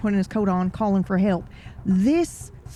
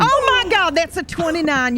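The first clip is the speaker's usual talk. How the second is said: excited; 9 semitones higher, 5 dB louder than usual. Real, not acted. GM reacts to dice roll.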